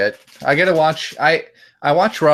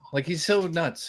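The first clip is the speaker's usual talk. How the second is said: usual speaking voice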